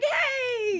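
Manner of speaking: imitated high, drawn-out hello; excited greeting. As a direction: mimic a friend's delighted, high-pitched greeting. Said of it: "you're like".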